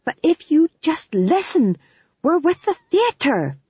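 high, loud, insistent pleading; urgent high plea. Acted. Prisoner explaining they're with the theatre, urgent high plea.

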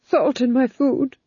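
high, slow, nervous aside; nervous Duke. A narrator's reading. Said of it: "said the Duke nervously".

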